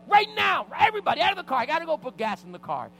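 piercingly high, loud command; freaking out. Retold or imitated. Father clearing the car to get gas, freaking out. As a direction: yell it as an urgent order. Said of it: "I was freaking out".

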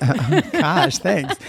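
laughing through the words. Real, not acted. To her teasing friend, laughing while talking. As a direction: laugh it out, caught off guard.

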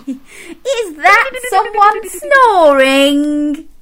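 high, slow, drawn-out question; playful incredulity. Pretend. Presenter quizzing children on the last sound, high mock amazement. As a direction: act amazed, stretching the question.

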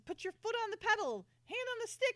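high, loud urgent commands; urgent orders. Retold or imitated. Lee ordering Amanda into the helicopter, high urgent command. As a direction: bark the flying orders. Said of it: "he goes".

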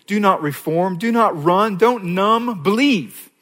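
each NOT rising, building up to Believe; rising exhortation. Real, not acted. Preacher urging listeners to believe, rising in pitch. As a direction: climb step by step to the appeal. Altar call list.

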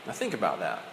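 ordinary talk, nothing marked

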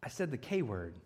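put-on small, hushed admission from a guilty kid; sheepish quiet confession. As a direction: mumble it like a kid owning up. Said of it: "And he says".

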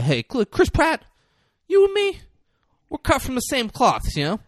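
high-pitched, hammed-up recruiter; pushy villain pitch. Acted villain pitching to trainer.

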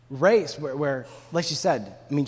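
usual speaking voice

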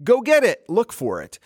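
high, bright command to a dog, dropping at the end. Retold or imitated. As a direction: call the dog to go find it. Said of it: "verbal command like".

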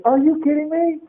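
high, disbelieving exclamation; mock-astonished outburst; incredulous. Real, not acted. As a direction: scoff at the very idea. Preacher scoffs at fearful thought.

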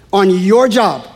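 usual speaking voice

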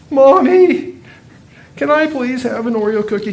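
put-on high pleading child voice; child begging. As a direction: beg like a little boy.

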